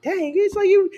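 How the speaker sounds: high, bright voice of self-praise. Retold or imitated. Recalling her own epiphany: high, amused self-praise. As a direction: cheer yourself on, high and light. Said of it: "had that epiphany where I was like".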